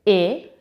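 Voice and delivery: I as short "ih", not the letter name; isolated short vowel "ih" for I; short I sound. Shown as a demonstration. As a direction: say I briefly as "ih". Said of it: "It's short sound".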